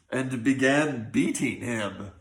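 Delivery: usual speaking voice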